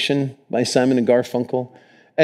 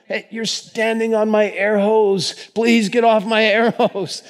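high-pitched, comic complaint for help; mock urgent plea; 8 semitones higher than usual. Diver begging someone off the air hose, high mock plea. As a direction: plead in a raised, put-on voice.

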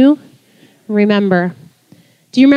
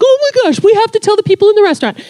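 much higher and fast, breathless with news; eager hurry to tell; excited rush; 11 semitones higher, 3 dB louder, faster than usual. Retold or imitated. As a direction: blurt it out, too thrilled to wait. Rushing to share news.

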